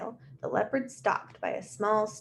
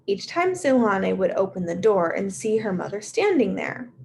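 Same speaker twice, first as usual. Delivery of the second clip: plain reading voice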